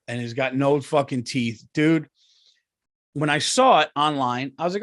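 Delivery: ordinary delivery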